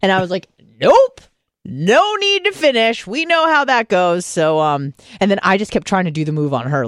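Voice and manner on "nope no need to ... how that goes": high emphatic refusal. Retold or imitated. Retold firm refusal.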